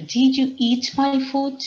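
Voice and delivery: high, loud, slow accusing dog voice; dog asking. Acted. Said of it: "the dog asked".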